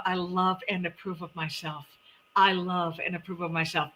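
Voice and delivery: soft, deliberate self-affirmation; mirror affirmation. Retold or imitated. Host re-enacting her bathroom mirror affirmation, soft and sincere. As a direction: quietly affirm yourself, word by word. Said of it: "when I run to that bathroom and I go".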